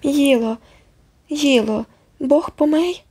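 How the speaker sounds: put-on high, lisping toddler question; frightened little boy. A narrator's reading. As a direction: ask it like a scared small child. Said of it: "круглими від страху оченятами".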